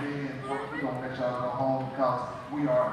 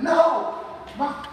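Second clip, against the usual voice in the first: voice breaking; 11 semitones higher, 4 dB louder than usual. Real, not acted. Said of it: "I'm sorry, I'm gonna take a minute".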